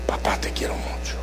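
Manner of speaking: soft, low whisper; whispered tender love. Retold or imitated. Son hugs his father and whispers love. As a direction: breathe it like a shy son. Son's tender words retold.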